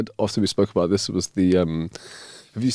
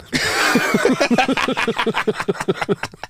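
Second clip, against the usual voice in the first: high breathy laugh; giggling; 11 semitones higher than usual. Real, not acted. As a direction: laugh at your own joke. Laughs at own joke.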